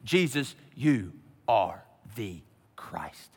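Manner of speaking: low, hushed and very slow, word by word; each word set apart with quiet finality; unhurried, low pronouncement, pausing after every word; slow, certain declaration. Acted. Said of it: "is making no mistake".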